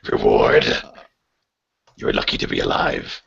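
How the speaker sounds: very deep monster voice; menacing creature voice. Acted. DM as captor refuses player a reward, deep menacing voice.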